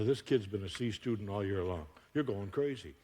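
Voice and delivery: ordinary talk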